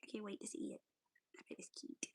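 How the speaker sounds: soft eager whisper. Real, not acted. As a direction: whisper it with delight. Excited about the game chicken.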